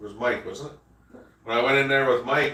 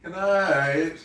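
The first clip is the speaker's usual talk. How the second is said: ordinary delivery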